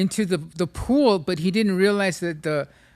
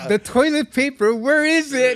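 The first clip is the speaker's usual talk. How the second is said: panicked search; 7 semitones higher, 5 dB louder than usual. Retold or imitated. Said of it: "You go".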